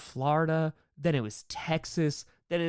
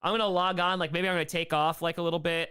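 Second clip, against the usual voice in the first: ordinary delivery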